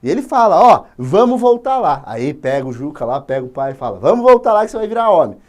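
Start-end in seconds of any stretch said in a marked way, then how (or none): 0.6-2.0 loud, high, slow order; stern command. Acted. Father ordering Juca back to the tribe. 4.0-5.4 loud, high, quick order; stern command. Acted. Father telling Juca to go back and be a man.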